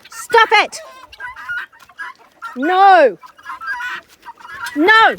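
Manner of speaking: loud, high warning; sharp command. Real, not acted. Owner stopping her dog from stalking, loud command. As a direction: snap a firm no.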